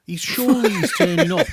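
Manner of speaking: words bubbling with laughter; laughing. Real, not acted. Host laughing about the Rock turning up, laughing. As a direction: laugh through the line.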